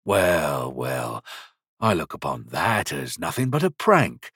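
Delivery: low, quiet, calm threat. A narrator's reading. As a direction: say it evenly, coolly menacing. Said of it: "He said this quite calmly".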